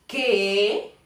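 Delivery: "qué" with a drawn-out rising question tune; doubtful question. Shown as a demonstration. Teacher showing a tone through a question, doubtful rising "qué". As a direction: let "qué" dip and climb as if doubting. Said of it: "cuando tenemos la duda de algo".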